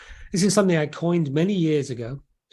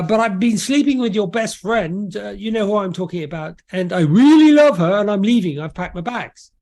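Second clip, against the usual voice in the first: higher-pitched acted confession, swelling on the love; confessing husband; 5 semitones higher, 5 dB louder than usual. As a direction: play a husband announcing he's leaving, lightly hammed-up. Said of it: "the husband walks in and says".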